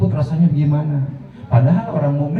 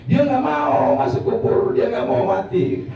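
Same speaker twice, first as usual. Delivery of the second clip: high, drawn-out refusal; mock reluctance; 9 semitones higher than usual. Preacher showing believers' reluctance to die, drawn-out mock refusal. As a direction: drag out the refusal, starting high.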